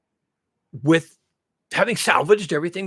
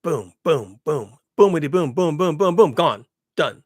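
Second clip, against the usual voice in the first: mock explosions; 5 dB louder, slower than usual. Imitating collapsing dominoes.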